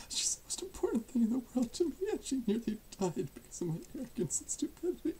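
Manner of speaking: high, tearful voice trailing into whispers. Acted. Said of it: "I don't normally cry like this".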